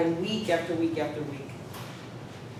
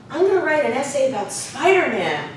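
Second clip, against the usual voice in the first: imitated eager kid, higher pitched; youthful childlike student; 5 semitones higher, 4 dB louder than usual. Student proposing an essay to his teacher, childlike and eager. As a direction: announce it with childlike enthusiasm. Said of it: "childlike way of speaking".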